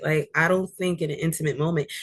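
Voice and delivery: ordinary delivery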